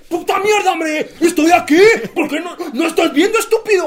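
shouting insults at a teammate, high and loud; mock furious yelling. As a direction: yell like an angry player on the pitch. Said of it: "de, de, de así". (grito furioso de futbolista)

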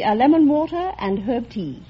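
ordinary delivery

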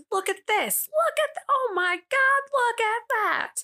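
over-the-top high-pitched spectacle hawker; crowd-pleasing showman. Acted. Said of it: "like, the crowd pleaser".

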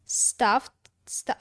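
ordinary delivery

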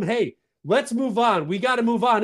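ordinary talk

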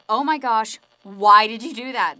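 loud, alarmed outcry; panicked bartender. Acted. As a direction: freak out at the shooting. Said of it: "He says".

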